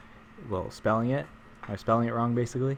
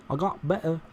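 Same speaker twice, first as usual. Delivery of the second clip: higher-pitched mock British accent; a quick, hammed-up British English voice; 6 semitones higher than usual. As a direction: put on a jokey British accent.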